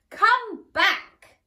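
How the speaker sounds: high, loud sharp call; cross governess snapping. Acted. Governess calls after the runaway princess, cross snap. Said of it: "snapped the cross governess".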